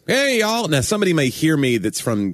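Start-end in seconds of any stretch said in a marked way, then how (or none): 0.1-0.7 high, hammed-up Southern holler; exaggerated Southern greeting. Podcaster showing what he doesn't do, exaggerated Southern greeting. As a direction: throw out a big Southern hello, pitched high. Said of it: "I'm not like".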